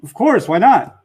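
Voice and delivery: accepting ice cream, emphatic eager yes; emphatic of course. Retold or imitated. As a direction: say of course why not with keen agreement.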